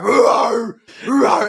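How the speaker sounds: high, loud mock roar of a big fan; imitated roaring. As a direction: bark it out like a bulldog fan. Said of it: "he's just barking at them".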